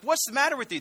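high-pitched startled question, acted out; mock alarm. Monks rushing to the frantic monk, mock-alarmed. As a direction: ask it high, like a bewildered onlooker.

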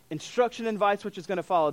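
normal talking voice

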